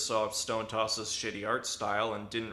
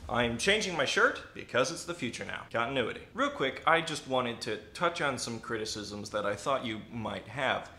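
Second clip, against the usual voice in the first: ordinary talk, nothing marked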